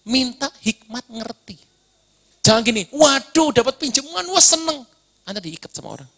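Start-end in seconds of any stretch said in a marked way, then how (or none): 2.9-4.8 mock delight. Mocking joy at a loan.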